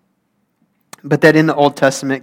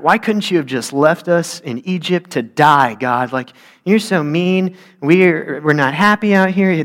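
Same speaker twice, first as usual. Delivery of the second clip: whining; 4 semitones higher, 3 dB louder than usual. Acted. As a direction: complain like a whiny child pleading upward. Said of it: "They just whine".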